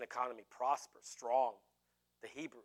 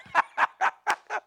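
loud, high-pitched cackling laugh; laughter; 8 dB louder than usual. Real, not acted. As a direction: cackle at your own joke. Laughs at own tyrant act.

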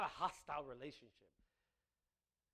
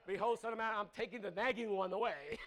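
loud, laughing mock proclamation; mock voice of God; 5 semitones higher, 8 dB louder than usual. Preacher joking God removes a nagging wife, laughing mock decree. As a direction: announce it like a joking deity. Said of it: "if she was a nagging woman".

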